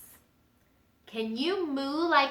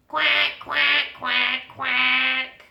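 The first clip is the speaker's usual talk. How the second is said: duck quacks; 3 semitones higher, 4 dB louder, much slower than usual. Acted. Mama duck quacking in song.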